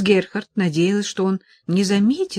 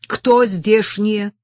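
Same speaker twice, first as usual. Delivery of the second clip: angry; 3 semitones higher, 6 dB louder, much slower than usual. A narrator's reading. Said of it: "Голос его зазвучал сердито".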